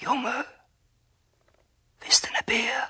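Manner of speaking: eerie breathed whisper; whispering tree. A narrator's reading. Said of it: "so flüstert der Birnbaum".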